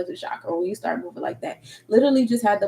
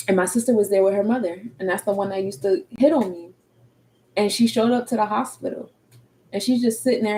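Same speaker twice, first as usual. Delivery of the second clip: normal talking voice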